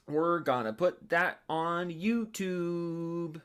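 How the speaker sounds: very slow, stretched-out odd voice; weird drawn-out voice. Acted. Streamer reacting to a donation, drawn-out goofy voice. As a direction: drag every word out strangely. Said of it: "I don't know why I said it like that".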